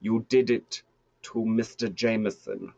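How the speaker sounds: put-on evil German crime boss accent. Boss confronting the man who struck his son, evil German accent. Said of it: "play the whole evil German thing".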